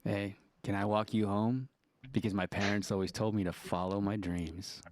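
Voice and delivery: low, soft come-on voice; seductive voice. Pretend. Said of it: "in your most seductive voice".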